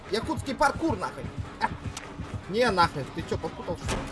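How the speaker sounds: ordinary talk, nothing marked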